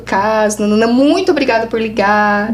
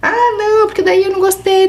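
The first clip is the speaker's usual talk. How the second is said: higher, evasive client voice; imitating a client; 9 semitones higher than usual. Client squirming in session, high evasive voice. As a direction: dodge the question, pitched up. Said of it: "client's evasive reply".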